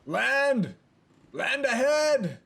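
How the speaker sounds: loud, high, drawn-out call; lookout's shout. Acted. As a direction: holler it from the top of a ship.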